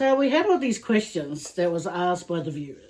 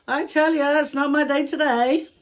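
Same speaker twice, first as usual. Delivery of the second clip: higher, louder fed-up complaint; exasperated; 6 semitones higher, 4 dB louder than usual. Real, not acted. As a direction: throw your hands up in despair. Dog barking during filming.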